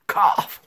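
squealing fake cough. Child fears losing head.

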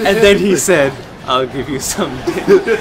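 loud words through real laughter; laughing while talking. Real, not acted. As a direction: crack up as you repeat the joke. Retelling the joke while cracking up.

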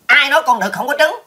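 high, loud protest; indignant retort. Acted. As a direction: contradict hotly, pitched right up. Indignantly contradicts the other speaker.

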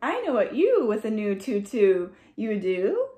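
plain reading voice

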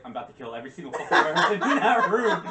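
fast and laughing; mock knight rattling off a threat; rushed threat. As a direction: spit it out in a hurry. Gregor voiced, laughing.

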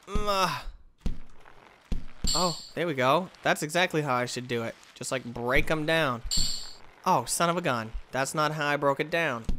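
ordinary talk

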